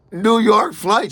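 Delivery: a loud, high, hurried ask; urgent call. Retold or imitated. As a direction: blurt out the flight in a rush. Urgent question to airport staff.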